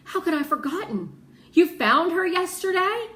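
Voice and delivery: high, loud astonished question; amazed. Acted. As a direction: let the surprise burst out. Piper amazed at the news.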